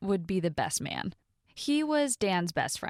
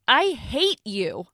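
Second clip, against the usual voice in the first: loud, drawn-out angry outburst; angry scream; 5 semitones higher, 7 dB louder, much slower than usual. A narrator's reading. Woman storming out on the best man, angry yell. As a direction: cry it out slowly and forcefully, stopping short of a full scream. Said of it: "I screamed at him".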